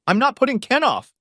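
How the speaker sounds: defiant wife snorting refusal; snorted. A narrator's reading. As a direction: scoff it defiantly. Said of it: "Scylla snorted".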